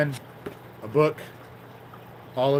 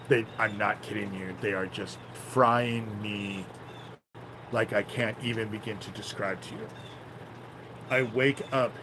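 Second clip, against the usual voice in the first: ordinary talk, nothing marked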